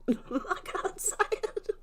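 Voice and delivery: high, slow words lost in laughter; too overcome with laughing to speak properly; cracking up. Real, not acted. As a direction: wheeze it out through giggles. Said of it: "Cracking up".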